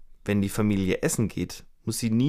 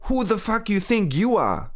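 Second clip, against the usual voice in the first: much higher, acted angry yell in English; angry shout; 10 semitones higher, 3 dB louder than usual. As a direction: let it burst out as a retold outburst. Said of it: "Sie ruft".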